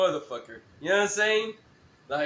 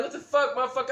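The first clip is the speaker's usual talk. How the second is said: mock challenge; 6 semitones higher, faster than usual. Confronting the guy.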